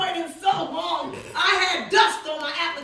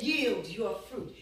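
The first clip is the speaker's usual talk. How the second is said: slow, low, softer declaration; retold second attempt; 5 semitones lower, 7 dB quieter, much slower than usual. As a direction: repeat it slowly, still restrained. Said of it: "Say it again".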